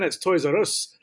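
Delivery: slowed mock-Scottish "Toys R Us"; Scottish accent. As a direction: put on a Scottish "Toys R Us", rolled r and "oos". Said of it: "Unless they're Scottish".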